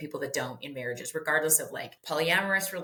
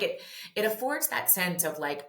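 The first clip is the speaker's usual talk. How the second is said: ordinary delivery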